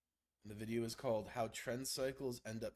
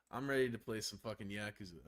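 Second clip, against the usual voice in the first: ordinary talk, nothing marked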